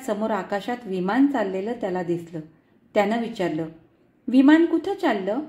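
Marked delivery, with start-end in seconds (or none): 4.3-5.4 put-on curious toddler. Toddler asking about plane.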